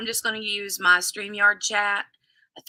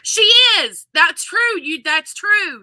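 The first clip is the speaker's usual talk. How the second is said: higher and louder, delighted; excited agreement; 8 semitones higher, 8 dB louder than usual. Real, not acted. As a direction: gush in agreement. Excited agreement after laugh.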